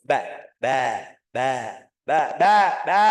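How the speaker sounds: BA loud and open; ringing, forceful BA, repeated; firm letter drill. Shown as a demonstration. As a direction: punch out each BA strongly. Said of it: "eh, kencang sedikit".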